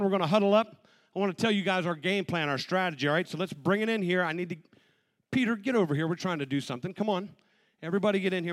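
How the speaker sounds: loud football coach rallying the huddle; halftime pep speech. Acted. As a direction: fire up the team like a coach. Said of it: "like a football scene".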